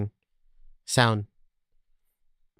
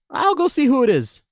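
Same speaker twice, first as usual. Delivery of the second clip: put-on boy voice pitched high and loud, first line slowed; eager young apprentice, much higher; Luke voice; 10 semitones higher, 4 dB louder than usual. Boy assistant boasting to the Professor, high and eager.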